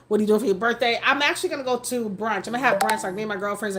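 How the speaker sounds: ordinary talk, nothing marked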